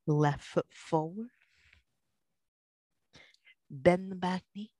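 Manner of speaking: ordinary talk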